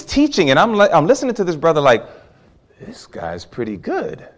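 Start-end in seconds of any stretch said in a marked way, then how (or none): 2.8-4.3 impressed under the breath. Retold or imitated. Impressed inner reaction.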